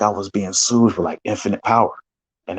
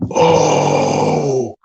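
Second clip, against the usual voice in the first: awed oh; 12 semitones lower, 4 dB louder than usual. Retold or imitated. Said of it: "everybody was going".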